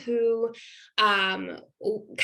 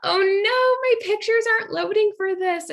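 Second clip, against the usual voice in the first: much higher and louder, fast flustered oh no; dismayed oh no; 12 semitones higher, 5 dB louder than usual. Real, not acted. Presenter to viewers as her pictures won't load, flustered. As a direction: caught off guard as the slides fail.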